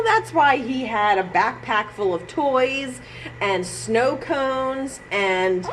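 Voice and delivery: normal talking voice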